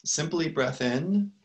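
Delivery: unhurried meditation guide with long pauses; calm, spacious guidance, letting each phrase settle; guided meditation. Real, not acted. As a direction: lead them gently into the breath, phrase by phrase.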